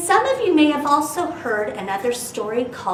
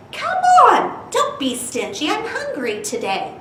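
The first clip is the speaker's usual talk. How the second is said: hungry goldfish; 10 semitones higher than usual. Acted. Fish begs for more food.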